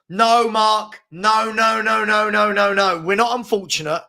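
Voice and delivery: loud, insistent objection; emphatic protest. Real, not acted. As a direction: cut in with a rapid string of refusals. Protesting a viewer's comment.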